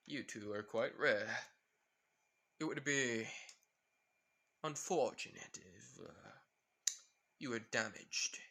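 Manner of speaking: slow, measured villain voice; unhurried, deliberate threat; menacing villain. Acted. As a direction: weigh each phrase with long pauses. Villain sizing up the kids.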